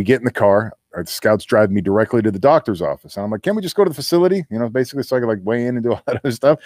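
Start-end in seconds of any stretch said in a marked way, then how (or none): 3.4-4.4 high, fast plea. Retold or imitated. Pleading with the scouts.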